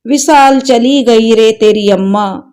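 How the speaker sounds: ordinary delivery, nothing marked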